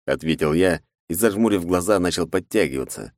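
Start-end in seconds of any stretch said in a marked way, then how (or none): none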